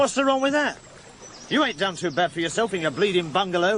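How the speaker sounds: high-pitched, indignant comeback; heated, quick-fire protest; indignant retort. Acted. Quarrel over the dead brother.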